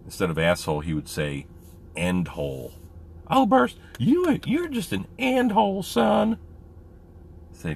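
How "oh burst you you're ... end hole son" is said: loud, very high mock scolding; Presley. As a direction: imitate a high-voiced buddy telling someone off. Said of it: "Had a little bit of a high voice".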